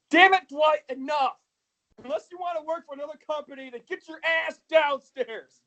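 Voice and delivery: high, stern bossy voice; stern boss voice. Retold or imitated. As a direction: order him downstairs. Imitating boss yelling at Dwight.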